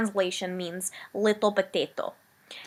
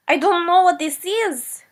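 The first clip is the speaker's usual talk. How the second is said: mock exasperation; 9 semitones higher, 8 dB louder than usual. Outrage at no yak.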